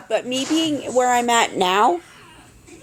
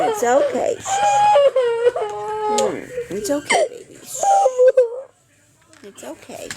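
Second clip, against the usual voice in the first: soothing a scared dog; 12 semitones higher than usual. Real, not acted. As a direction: hush and calm a frightened dog.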